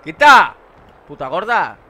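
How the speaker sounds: loud shout at the screen. Real, not acted. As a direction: yell it out. Yelling at game character.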